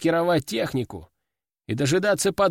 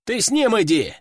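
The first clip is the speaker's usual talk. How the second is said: shouted order; 6 semitones higher than usual. Acted. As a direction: call it across to him. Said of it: "крикнул он".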